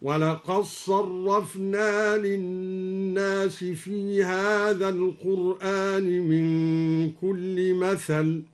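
slow chant, dal of ولقد merged into doubled ص of صرفنا; assimilated recitation. Shown as a demonstration. Teacher demonstrating the merged reading of the verse, slow Quranic chant. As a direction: recite ولقد صرفنا as walaqaṣ-ṣarrafna, long drawn vowels. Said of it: "وأدغم الدال في الصاد".

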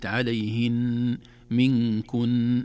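عليهن منكن with the nun held doubled, slow. Shown as a demonstration. Teacher illustrating a doubled nun, slow chant. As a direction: hold the doubled nun long. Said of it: "النون المشددة في كلمة".

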